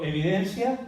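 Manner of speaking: loud lead word for the crowd; call for repetition. Shown as a demonstration. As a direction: lead the congregation. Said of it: "Diga conmigo".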